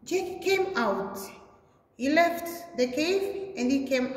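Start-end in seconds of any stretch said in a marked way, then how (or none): none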